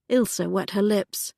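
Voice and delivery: ordinary delivery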